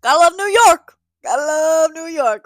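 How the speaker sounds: high, very loud mock cheer; big exasperated shout to the city; sardonic shout. Real, not acted. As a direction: holler it sarcastically, drawing out the second one. Sardonic outburst about chaotic city.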